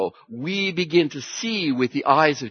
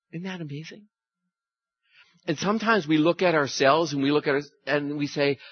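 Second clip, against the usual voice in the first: usual speaking voice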